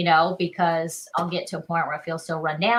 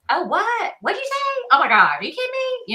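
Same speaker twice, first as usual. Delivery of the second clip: high-pitched, indignant Southern phone voice; angrier Southern accent; 6 dB louder than usual. Acted. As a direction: play up the Southern twang, outraged on the phone. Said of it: "the more Southern you sound".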